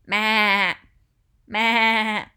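loud, quavering bleat; sheep bleating. Shown as a demonstration. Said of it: "it's a sheep".

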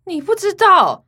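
high, slow, mocking "you don't know?"; incredulous disbelief. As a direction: scoff at her ignorance. Granddaughter scoffs at cousin.

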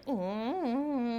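slow, soft imitated mosquito hum; mosquito buzz.

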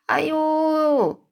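high, loud wild giant cackle in his sleep; mad laughter. A narrator's reading. Sleeping giant in a nightmare, crazed high yelling laugh. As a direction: howl it out like a giant gone mad. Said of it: "这吞噬巨人开始狂笑了".

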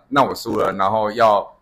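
usual speaking voice

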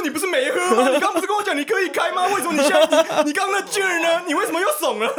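high, loud, laughing disbelief; incredulous laughing. Retold or imitated. Son confronting his father about drinking, high and laughing. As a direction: call his bluff through laughter. Calling out dad's drink driving.

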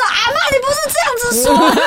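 very high mock wail of protest; crying harder. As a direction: bawl at granny, pitched right up. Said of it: "我还哭更惨".

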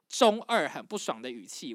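ordinary delivery

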